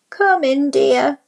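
a sweet granny calling from bed; grandmother calling. A narrator's reading. Grandmother to the visitor, high welcoming call. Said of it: "called Grandmother".

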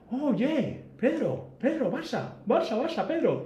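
voz muy aguda y entusiasta de fan; imitando al vietnamita. Retold or imitated. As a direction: chillido agudo de admirador extranjero. (imitación aguda del recepcionista vietnamita emocionado)

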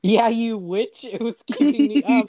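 high, loud laughing retort. Real, not acted. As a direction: tease back while laughing.

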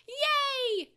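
put-on high, loud childlike yay; happy cheer. Boy cheering at the mushroom, high and loud. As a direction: burst out with joy. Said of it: "Takeru said happily".

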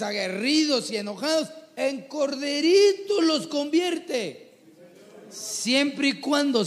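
high, slow, drawn-out preaching; fervent preaching. Acted. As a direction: stretch every word out to the congregation.

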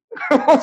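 a quick high chuckle; short laugh. Real, not acted. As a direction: laugh at the absurd moment. Ri da situação. (risadinha aguda)

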